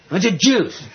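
high, punchy imitation of a small child's demand; demanding little kid. Small son demanding juice from his mother, imitated high and insistent.

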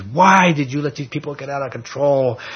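half-acted rebuke of Aaron; mock angry Moses. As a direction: Moses scolding his brother Aaron, mildly angry. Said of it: "he is just fuming mad".

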